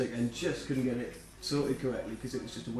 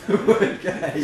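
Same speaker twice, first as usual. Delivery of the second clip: laughing; 4 semitones higher, 6 dB louder, much slower than usual. Real, not acted. Punchline after language remark, laughing.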